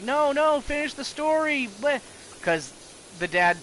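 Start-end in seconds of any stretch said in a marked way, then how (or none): 0.0-2.0 high-pitched mock child protest; pleading kid. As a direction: whine for the story like a kid, much higher. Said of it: "the kid's like".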